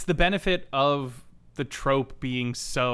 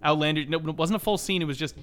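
normal talking voice

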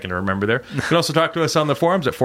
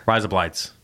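said quickly. Shown as a demonstration. Host showing the Australian joke, quickly run together. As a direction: rise up lights run together fast as one word. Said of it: "quickly, it's".

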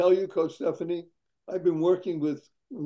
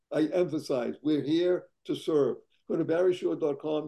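ordinary talk, nothing marked

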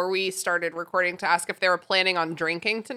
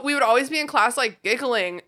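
ordinary delivery

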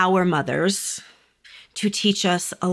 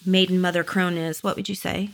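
normal talking voice

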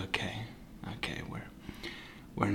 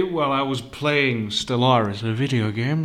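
normal talking voice

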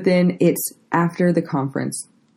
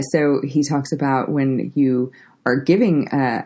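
ordinary delivery

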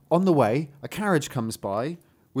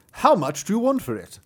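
higher, projected buyer's voice; haggling gentleman; 6 semitones higher, 4 dB louder than usual. Acted. Gentleman asking a father the dog's price, projected character voice. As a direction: ask the price as a storybook gentleman.

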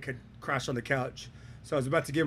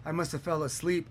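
fake contrite driver; 3 semitones higher than usual. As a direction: play the innocent victim, begging forgiveness. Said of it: "completely put on a show".

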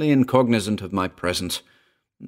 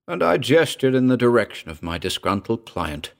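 usual speaking voice

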